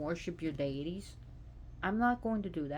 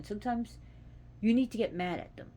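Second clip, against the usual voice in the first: ordinary talk, nothing marked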